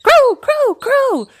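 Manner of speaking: very high, loud bird calls; flock taking up the call. A narrator's reading. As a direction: shout it like a shrill little bird. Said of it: "The others picked up the call".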